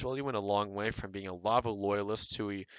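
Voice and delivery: ordinary talk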